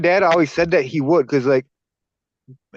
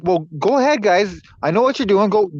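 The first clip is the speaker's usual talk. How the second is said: high, bright, inviting mom voice; cheerful mom voice; 7 semitones higher than usual. Retold or imitated. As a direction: coax them like a cool mom. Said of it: "And she's like".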